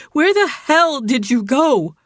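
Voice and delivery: demanding. A narrator's reading. Jilly demanding to know where Dylan went, slow and loud. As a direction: drag the answer out of him.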